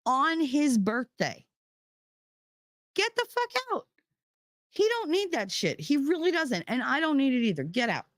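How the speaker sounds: high, rising furious telling-off; heated rant. Real, not acted. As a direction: let the anger climb until it cracks. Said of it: "I get heated".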